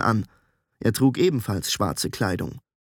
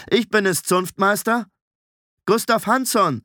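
raised, high-pitched call; 9 semitones higher than usual. A narrator's reading. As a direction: announce yourself through a closed door. Calling through a closed door.

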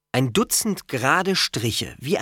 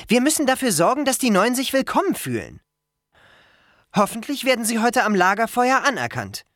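put-on high, earnest girl's voice; firm, welcoming Annabeth; 11 semitones higher, faster than usual. A narrator's reading. Girl wants newcomers to feel welcome, firm and high. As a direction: speak up for the newcomers, pitched high.

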